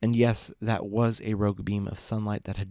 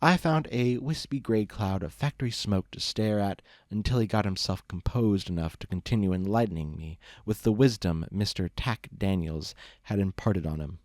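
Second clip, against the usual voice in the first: plain reading voice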